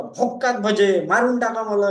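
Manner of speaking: put-on loud high Marathi-accented drunk wail. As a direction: shout it in a thick Marathi accent. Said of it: "उसका एक Marathi accent रहता था". (मराठी लहजे में नशे में चिल्लाना)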